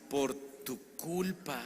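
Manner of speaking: reproche lento, palabra por palabra; reproche imitado. Retold or imitated. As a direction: acusa pausadamente, imitando a los compañeros. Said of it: "y me dicen". (reproche contenido y muy pausado)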